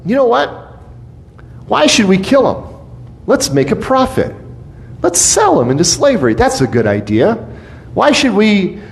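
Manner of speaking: mock Judah. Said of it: "So Judah's like".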